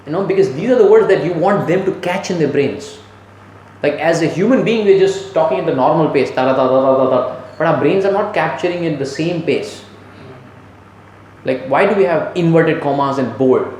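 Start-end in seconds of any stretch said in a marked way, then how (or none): none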